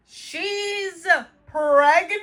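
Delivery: high, loud, stretched disbelief; shocked. Real, not acted. Viewer discovering the pregnancy, shocked. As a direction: draw out each word, aghast.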